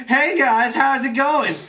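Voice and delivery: breezy, as if nothing happened; casual greeting. Retold or imitated. Unmasked villain greets his attackers.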